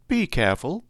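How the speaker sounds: put-on high, girlish cry of warning; Green Girl. A narrator's reading. Green Girl warning Dorothy about her tears, high-pitched. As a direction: call it out high, as a quick caution. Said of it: "cried the Green Girl".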